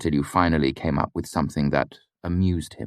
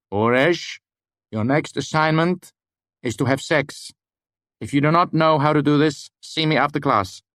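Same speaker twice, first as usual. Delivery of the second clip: higher, deadpan teacher voice; mock-serious lecturer; 10 semitones higher, 3 dB louder than usual. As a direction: deliver the joke straight-faced. Lecturer gives mock-serious order.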